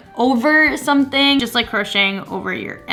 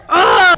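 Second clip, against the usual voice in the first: frustrated groan; 7 semitones higher, 7 dB louder than usual. Real, not acted. Must restart everything.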